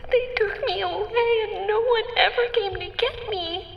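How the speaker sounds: high, tearful and strained. Acted. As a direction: plead through tears. Tearful accusation, high pitch.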